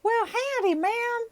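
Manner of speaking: very high, loud puppet voice with a Texan twang; Elmo. Acted. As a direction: squeal a Texan greeting as a baby puppet. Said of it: "he thinks he's from Texas".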